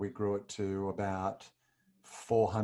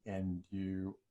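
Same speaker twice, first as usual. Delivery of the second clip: choked up; 6 dB quieter, much slower than usual. Real, not acted. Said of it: "I can't believe how emotional I'm getting".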